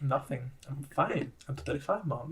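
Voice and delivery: put-on sulky kid. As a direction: shrug it off like a sullen kid. Sulky kid answering parent.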